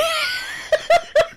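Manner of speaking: high wheezy burst of laughter; real laughing fit. Real, not acted. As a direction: crack up mid-story. Cracks up telling his story.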